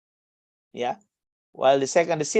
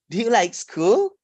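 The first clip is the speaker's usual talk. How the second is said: "Do you like school" higher, swinging up on SCHOOL; rising intonation; 5 semitones higher than usual. Shown as a demonstration. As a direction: lift "school" high to end the question. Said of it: "a rising intonation".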